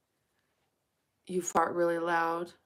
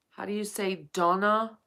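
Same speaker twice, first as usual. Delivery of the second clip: ordinary talk, nothing marked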